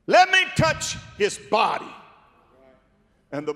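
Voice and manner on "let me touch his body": high, loud devil begging; the devil pressing his request; devil's voice. Acted. Devil voiced in Job story.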